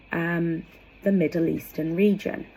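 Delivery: normal talking voice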